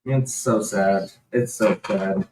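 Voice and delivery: slow, soft and sad, near crying; close to tears. Real, not acted. Telling her partner about her dying grandmother, slow and close to tears. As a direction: let the grief pull the words down slowly.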